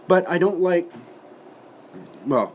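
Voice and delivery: normal talking voice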